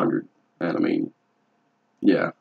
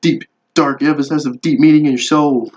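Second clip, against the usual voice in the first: snooty tattoo artist; 6 semitones higher than usual. Retold or imitated. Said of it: "'cause like".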